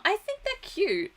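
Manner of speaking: high, cooing and sweet; excited anime fan. Real, not acted. Gushing over cute anime girls.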